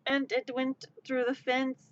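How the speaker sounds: ordinary delivery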